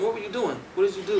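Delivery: high, eager questions; excited questions. Retold or imitated. Hearing a freestyle from the next room, excited and high. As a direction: call out, curious and thrilled.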